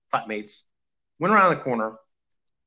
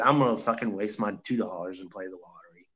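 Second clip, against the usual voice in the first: muttered resolve; 8 semitones lower, 6 dB quieter than usual. Retold or imitated. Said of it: "I said".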